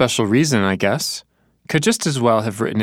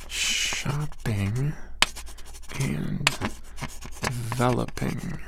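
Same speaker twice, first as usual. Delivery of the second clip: slow, much softer word-by-word dictation; writing aloud; 11 dB quieter, much slower than usual. Shown as a demonstration. [name] writes Marta's sentence, dictating quietly to himself. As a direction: spell it out under his breath as he writes. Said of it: "How are they written?".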